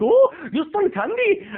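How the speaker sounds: high, demanding mock Indian accent; hammy Indian-accented shop owner. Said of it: "giving this guy an Indian accent".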